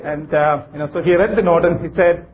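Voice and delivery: ordinary talk, nothing marked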